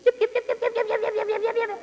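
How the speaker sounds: high, rapid yips like a tiny terrier; high yipping imitation. Pastor imitating his terrier yapping at the door. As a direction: yap like an anxious little rat terrier.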